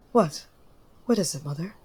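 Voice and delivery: usual speaking voice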